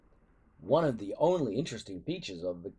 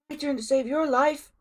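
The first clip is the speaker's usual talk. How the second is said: gasped; 14 semitones higher, 4 dB louder than usual. A narrator's reading. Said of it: "gasped Legs".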